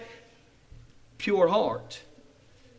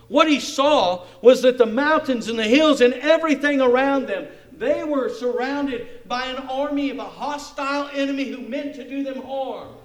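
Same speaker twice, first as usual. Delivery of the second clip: loud, fervent reading of the prayer; full-force preaching; 5 semitones higher, 7 dB louder than usual. Acted. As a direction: proclaim the verse to the crowd. Proclaims the vision.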